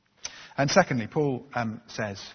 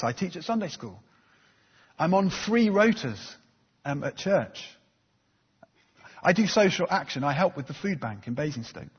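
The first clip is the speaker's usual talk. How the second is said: proud churchgoer boasting; 4 semitones higher, 4 dB louder than usual. Retold or imitated. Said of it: "I might say something like this".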